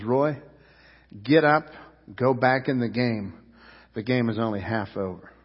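steady him gently, with pauses between phrases; imitating a coach's soft, firm urging; acted calm reassurance. Coach gently urging the crying player.

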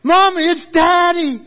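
put-on high, loud scream of a thrilled little girl; acted daughter yelling for her mother; excited child's scream. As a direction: shriek it joyfully at the top of your lungs, drawing out the end. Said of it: "she begins to scream at the top of her lungs".

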